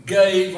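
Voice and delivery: gave hit hard and loud; stress on gave. Shown as a demonstration. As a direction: punch the word gave. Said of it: "I want you to know the word either give".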